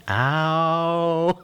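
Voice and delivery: long, high-pitched mock howl of pain; imitated pain scream. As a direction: yell a drawn-out ow, reenacting agony.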